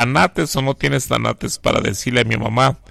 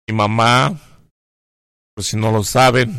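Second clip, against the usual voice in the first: ordinary talk, nothing marked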